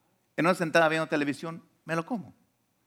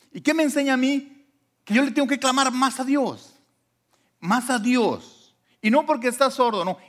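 high, louder preaching climax; 11 semitones higher, 5 dB louder than usual. Acted. Pastor urging the congregation to cry out more to God, fervent preaching. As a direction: drive the point home from the pulpit.

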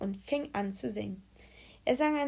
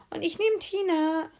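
put-on high, louder girl's call; calling out, child Hannah; 8 semitones higher, 5 dB louder than usual. A narrator's reading. Hannah calling out to the teacher, high and eager. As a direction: chime in eagerly, pitched up. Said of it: "rief Hannah".

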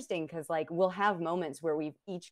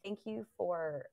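soft, slow tender opening; gentle honey; 3 semitones higher, 7 dB quieter, slower than usual. Retold or imitated. Wife gently opening a hard talk with her partner, tender. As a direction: start softly and kindly. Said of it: "I was like".

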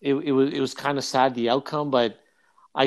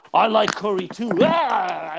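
mock gleeful outburst; 5 semitones higher, slower than usual. Retelling the priest's outburst.

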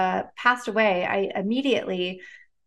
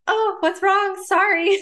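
much higher, louder apologetic friend; worried friend; 11 semitones higher, 5 dB louder, slower than usual. Retold or imitated. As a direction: voice the friend's startled apology, pitched up. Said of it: "she's like".

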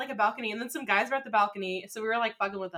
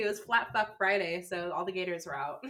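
ordinary talk, nothing marked

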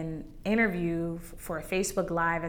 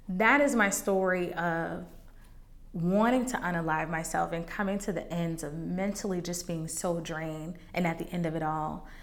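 ordinary delivery

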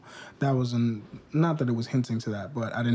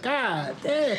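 laughing impression; 10 semitones higher than usual. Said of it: "He's like".

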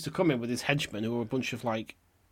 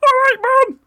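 much higher, much louder mock Northern England accent answering his mum; 13 dB louder than usual. Teenage boy answers his mum across the shop, loud Northern England accent. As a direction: call back to your mum in a broad Northern England accent. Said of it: "in a very Northern England accent".

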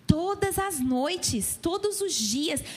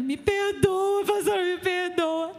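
slow, drawn-out tearful apology, pitched up; begging forgiveness, voice half-crying; tearful plea; 4 semitones higher, much slower than usual. Retold or imitated. Woman crying to her pastor at the baptism, slow tearful plea. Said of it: "Chegou pra mim chorando".

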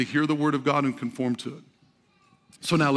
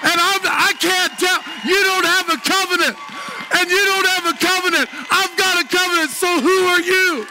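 high, fired-up sermon delivery; full-force preaching; 12 semitones higher, 4 dB louder than usual. Real, not acted. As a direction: preach it boldly at a high pitch to a roused congregation.

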